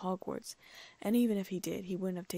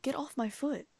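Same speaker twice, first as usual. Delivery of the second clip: pained yell; 6 semitones higher than usual. A narrator's reading. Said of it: "winced in pain and yelled".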